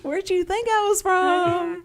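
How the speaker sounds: high, stretched-out Texas drawl. Acted. Joking about being asked where she's from, broad Texas accent. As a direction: draw it out slow in exaggerated Texan. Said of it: "You have to talk like Connie".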